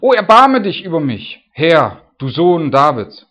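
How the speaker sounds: loud, high, drawn-out plea; crying out for mercy. Acted. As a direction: call out imploringly, stretching the words. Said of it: "die rief ihn an". (laut flehender, gedehnter Ausruf)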